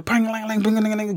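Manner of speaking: high, light clang-clang imitation; clanging pots. Teller imitating pots and pans crashing, high and light. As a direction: sing out the clatter of pots.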